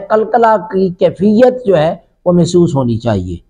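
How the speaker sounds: ordinary delivery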